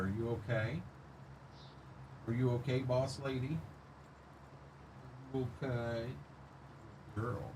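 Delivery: hushed, gentle murmur to the dogs; soft dog talk. Real, not acted. Streamer checks on his dog in the tractor, hushed. Talking softly to dogs in cab.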